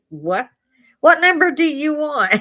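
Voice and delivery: high, loud disbelieving repeat of her question; incredulous echo. Retold or imitated. Officer echoing the drunk driver's question, incredulous. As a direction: throw her words back at her, stunned. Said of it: "He said".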